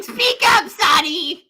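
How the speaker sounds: through an ear trumpet. Acted. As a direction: yell like a hard-of-hearing grandpa. Old man can't hear whisper.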